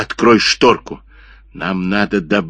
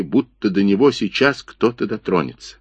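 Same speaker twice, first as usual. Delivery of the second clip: ordinary delivery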